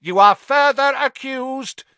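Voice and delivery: high, loud judicial bellow; bellowed accusation. A narrator's reading. Crouch overrides his shrieking son, bellowed accusation. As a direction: bellow the charge over the screaming. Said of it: "bellowed Mister Crouch".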